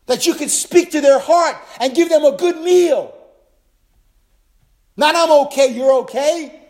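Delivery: loud, high, fervent preaching; full-force preaching. Acted. Pastor exhorting pastors to feed the remnant, preaching loud. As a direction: preach it out with rising fire.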